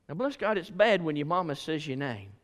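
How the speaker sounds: usual speaking voice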